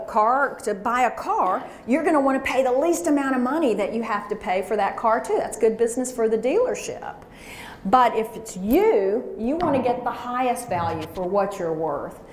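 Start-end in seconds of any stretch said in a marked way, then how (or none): 5.4-7.1 whispered aside. Acted.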